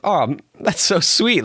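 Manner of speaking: slow, high, drawn-out gush of being touched. Retold or imitated. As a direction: gush like the kind words caught you off guard. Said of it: "And I was like".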